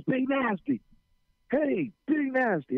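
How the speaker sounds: high, drawn-out call across a crowd; calling out his name. Retold or imitated. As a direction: call him from a distance, slowly. Kid calling him at game.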